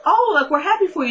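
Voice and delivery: high-pitched imitation of being happy for her; masking congratulations. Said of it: "it was masked by that".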